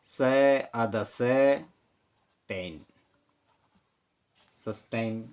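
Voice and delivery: slow, drawn-out syllables joined into सस्टेन; sound-by-sound blending. Shown as a demonstration. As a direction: piece सस्टेन together slowly. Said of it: "तो ये spelling होगी".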